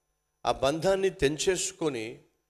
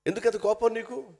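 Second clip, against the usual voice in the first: ఎందుకు అంత కోపం నీకు asked far higher, urgent; raised pleading; 10 semitones higher than usual. Shown as a demonstration. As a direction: plead with an angry man to calm down. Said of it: "కొంచెం control అవ్వు". (ఎక్కువ స్వరంతో బతిమాలుతూ)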